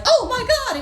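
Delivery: high shrieked Oh my God; a startled high yelp; mock scream. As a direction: shriek at the bug. Screaming at a cockroach.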